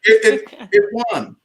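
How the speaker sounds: way up high, insisting; high-pitched mock protest; high insistent. Real, not acted. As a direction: squeak out that it won.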